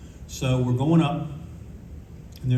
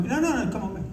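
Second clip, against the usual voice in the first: flustered mock politeness; 8 semitones higher, 4 dB quieter than usual.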